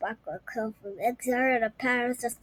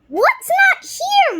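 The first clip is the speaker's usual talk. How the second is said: put-on high, loud, slow child voice; child's question; 10 dB louder, slower than usual. Little girl asking mom what's missing, high and slow. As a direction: ask it wide-eyed.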